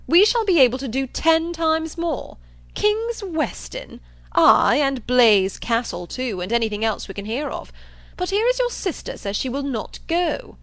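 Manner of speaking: loud, high, emphatic boasting; blustering John Thorpe. Acted. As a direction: brag over all objections.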